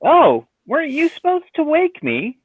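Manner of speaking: high, startled question; surprised in character. Acted. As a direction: ask it with sudden surprise.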